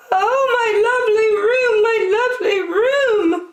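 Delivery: high, wailing lament; crying out. A narrator's reading. Woman wailing over her painted apartment, crying. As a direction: wail over the ruined room. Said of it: "cried the woman".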